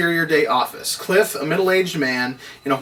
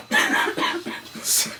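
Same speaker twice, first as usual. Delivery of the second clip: a quiet, breathy chuckle; soft airy laugh; 4 dB quieter than usual. Real, not acted. Reader amused at his own mistake, soft laugh. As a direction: let a small laugh slip out.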